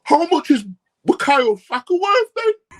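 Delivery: high, loud, slow mock question; mocking fan voice. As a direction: parody a fan pricing his own player. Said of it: "talking like this".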